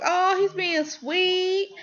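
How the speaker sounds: high, slow, gushing coo; mock swooning. Fan gushing over the lead's sweetness, mock swooning.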